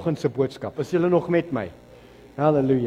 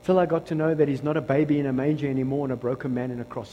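usual speaking voice

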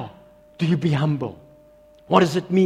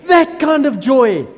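joyful emphasis; 9 semitones higher, 11 dB louder, slower than usual. Real, not acted. Preacher winding down from shout.

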